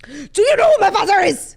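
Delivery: high, loud put-on Austrian accent; mock the drunk heir in Austrian; a hammed-up Austrian heir yelling at the door. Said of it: "I don't know what the accent was giving".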